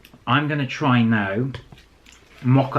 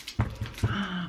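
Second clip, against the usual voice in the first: gasp; 6 semitones higher, 9 dB quieter than usual. Real, not acted. Realises they forgot scoring.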